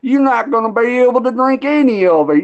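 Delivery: high, mocking Southern local voice; goofy Southern accent. Locals taunting the Yankee who wants moonshine, in a goofy high Southern accent. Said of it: "They all sound goofy as hell down here".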